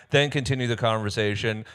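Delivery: ordinary talk, nothing marked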